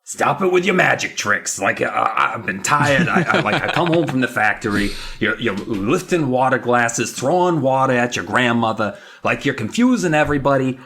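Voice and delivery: worn-out working dad, dropping his r's; hammed-up blue-collar dad rant; exasperated dad. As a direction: gripe haltingly like a tired factory father. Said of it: "the dad's just like".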